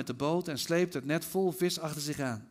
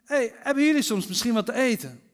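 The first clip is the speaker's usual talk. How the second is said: higher, louder call to people far off; 7 semitones higher, 5 dB louder than usual. A narrator's reading. Jesus on the shore hails the fishermen in their boat, calling out. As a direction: call across the water with a friendly question. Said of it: "En hij riep".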